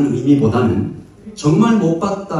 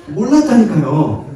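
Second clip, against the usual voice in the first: exasperated; 5 semitones higher, 6 dB louder than usual. Pretend. Brother exasperated at repeated questioning.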